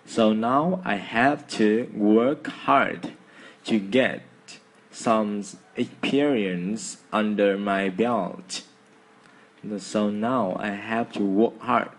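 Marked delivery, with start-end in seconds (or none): 0.1-4.2 very slow, each word spaced out. Shown as a demonstration. Teacher reading slowly word by word to students. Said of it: "từng từ một chậm hơn nhá". 4.9-8.6 very slow, every word separate. Shown as a demonstration. Teacher slowing down so students can follow. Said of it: "từng từ một chậm hơn nhá".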